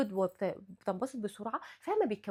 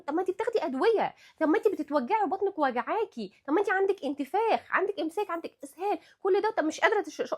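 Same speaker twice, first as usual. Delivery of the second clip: higher, exasperated scolding of a fussy patient; mock scolding; 6 semitones higher, 4 dB louder than usual. Doctor scolding a patient who won't take olive oil, higher and exasperated. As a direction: scold her as if to say really, this is what bothers you.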